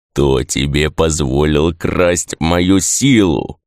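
slow, low creaking menace; drawn-out, deep creaky demand; creaky spirit voice. A narrator's reading. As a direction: creak it out like an unseen spirit at someone's ear. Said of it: "проскрипел над ухом невидимый Кодзу".

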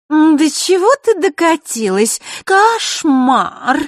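loud, high-pitched scornful exclamation; contemptuous. A narrator's reading. Marishka belittles Vasilisa before her friends, loudly contemptuous. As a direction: sneer it high and loud for all to hear. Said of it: "презрительно произнесла хрустальная ключница".

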